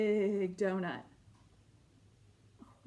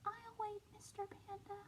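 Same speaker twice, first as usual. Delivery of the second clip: a much softer, small little penguin voice; very quiet; 10 semitones higher, 14 dB quieter than usual. Acted. Little penguin patiently telling Mister Panda, quietly. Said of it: "said it very quiet like this".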